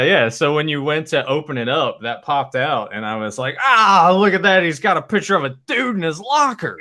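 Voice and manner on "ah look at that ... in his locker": high, loud, gleeful taunt; mock outcry. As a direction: call it out to embarrass him, much higher. Mimics pointing out the prank loudly.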